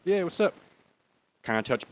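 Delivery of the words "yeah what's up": high, startled pickup of the phone; surprised answer. Retold or imitated. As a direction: caught off guard, then casual. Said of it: "I'm like".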